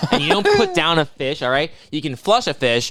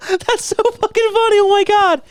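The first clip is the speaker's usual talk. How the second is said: very high, louder giddy gush; mock giddy squeal; 5 dB louder than usual. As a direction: squeal it like a delighted kid. Imitating friend amused by TV.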